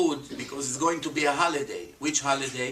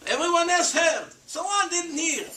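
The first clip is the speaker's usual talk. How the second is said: much higher and louder, hitting the contrast; emphatic high exclamation; 11 semitones higher, 3 dB louder than usual. Real, not acted. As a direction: cry it out to make them see.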